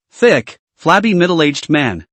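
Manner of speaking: usual speaking voice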